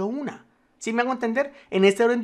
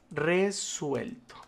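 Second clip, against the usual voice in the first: slow dictation; 6 dB quieter, much slower than usual. Shown as a demonstration. Dictating cell text slowly.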